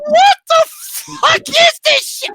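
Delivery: very high, loud, slow yell; exasperated shrieked reaction; incredulous outburst. Retold or imitated. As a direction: stretch it out in utter disbelief. Retells baffled reaction to the scene.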